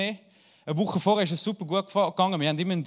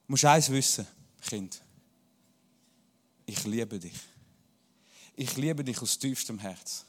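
tender bedtime voice; 7 semitones lower, 6 dB quieter than usual. Retold or imitated. Father at child's bedtime.

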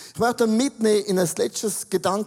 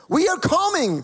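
mock holiday excitement; 8 semitones higher, 4 dB louder than usual.

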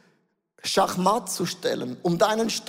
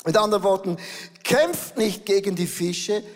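usual speaking voice

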